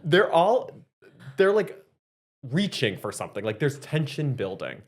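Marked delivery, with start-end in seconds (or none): 0.0-1.8 high, loud laugh through words. Real, not acted. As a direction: laugh hard while talking. Laughing at own accent.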